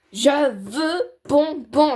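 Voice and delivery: loud, slow whining demand; spoiled child. Acted. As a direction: stretch it out like a spoiled brat.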